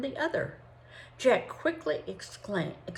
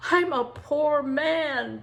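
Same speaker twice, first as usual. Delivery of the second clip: slow, higher, louder groan of despair; 8 semitones higher, 6 dB louder, much slower than usual. Acted. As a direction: drag it out like a beaten man. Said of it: "groaned the Yankee trader".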